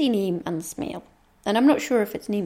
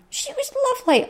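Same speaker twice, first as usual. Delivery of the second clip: gushing; faster than usual. Real, not acted. Gushing about a vendor.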